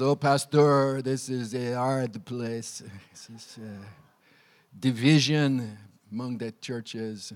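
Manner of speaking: put-on low, slow and lightly Russian-accented, heavy on each word. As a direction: voice Pierre's gloomy warning in a mild Russian accent. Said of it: "that's Russian".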